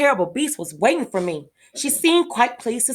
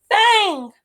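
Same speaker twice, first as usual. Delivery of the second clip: high, loud call after him; a child calls after her runaway pet, high and loud; 11 semitones higher, 5 dB louder than usual. Acted. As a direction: shout his name as he runs off. Calling after the runaway beast.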